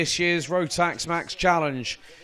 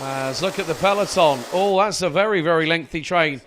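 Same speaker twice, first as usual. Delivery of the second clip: excited, raised race play-by-play; motorsport commentator; 3 semitones higher, 3 dB louder than usual. Acted. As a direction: call the race live and keen. Live race play-by-play, pre-final start.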